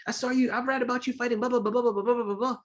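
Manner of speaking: put-on high-pitched starstruck kid voice; high little-kid chatter, rattling on; excited kid. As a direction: gush it high like a young fan. Retells himself as excited kid.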